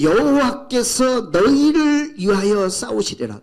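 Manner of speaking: very slow, weighty scripture recitation; slow proclamation. Acted. Preacher proclaiming the Lord fights for you, very slowly. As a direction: draw out each word solemnly. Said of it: "세 번째로 뭐라고 말씀하시냐면".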